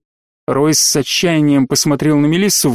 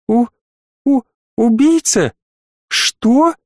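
high, stammering fright; frightened stammer; 8 semitones higher, slower than usual. Acted. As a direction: stutter in sudden fear.

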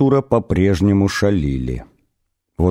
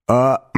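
blurted "ah", then throat clearing; blurted false start; 4 semitones higher than usual. A narrator's reading. Arthur struggling for control of his vocal cords, blurted false start. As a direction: try to speak and only clear your throat. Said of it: "выпалил он".

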